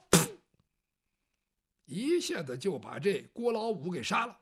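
ordinary delivery

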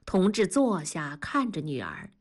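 plain reading voice

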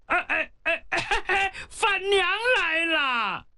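a simple giant's delighted shout; overjoyed cry. Acted. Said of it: "他高兴了". (憨傻的欢喜叫喊)

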